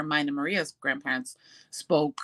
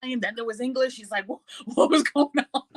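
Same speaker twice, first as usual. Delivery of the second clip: husband puzzled; 7 semitones higher, faster than usual. Retold or imitated. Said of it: "He goes".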